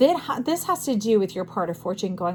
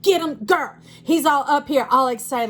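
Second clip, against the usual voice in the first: high, loud cheering voice as Big Papa; excited cheer; 5 semitones higher, 5 dB louder than usual. Retold or imitated. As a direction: egg her on, high and loud. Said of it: "And Big Papa's like".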